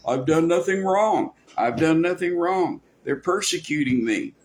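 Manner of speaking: mock self-justifying plea; protesting innocence. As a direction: imitate someone on TV claiming innocence. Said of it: "sounds like a guy on T V sometimes".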